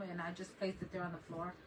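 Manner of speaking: ordinary delivery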